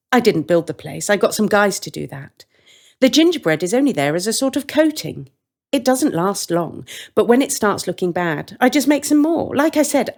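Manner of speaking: a sweet, carefree baker explaining; the woman's voice. Acted.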